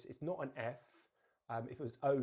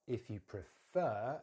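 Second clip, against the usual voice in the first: slow "prefer", weight on "fer"; careful model, "prefer" stressed at the end; stress on second syllable; much slower than usual. Shown as a demonstration. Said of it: "stress is on the fer".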